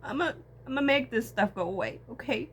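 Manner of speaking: high, loud sobbing vow; forcing the words out through sobs; real crying. Real, not acted. Vlogger vowing through tears, sobbing loudly.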